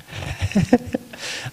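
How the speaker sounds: breathy laugh. Real, not acted. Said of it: "names aside laugh".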